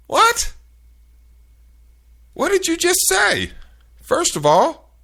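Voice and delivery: very high, loud shocked what; squealed outraged what; incredulous what. Retold or imitated. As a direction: shriek it in utter disbelief. Stunned by agent's answer.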